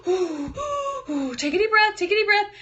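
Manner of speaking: high breathy self-soothing; calming herself. Retold or imitated. Mom calming herself before she snaps at the kids. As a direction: talk yourself down with breaths. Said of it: "I was like".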